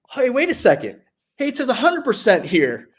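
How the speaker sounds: excited surprise. Retold or imitated. Veteran reading his full rating to his friend, in excited surprise. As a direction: gasp it out as if you can't believe your eyes. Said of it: "I was like".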